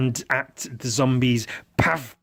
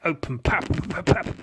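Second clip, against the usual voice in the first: mouth gun noises; 5 semitones higher than usual. Pretend. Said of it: "that's my gun noise".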